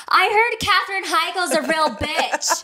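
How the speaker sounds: loud, laughing mock blurt; laughing imitation. Mimicking friend's loud remark.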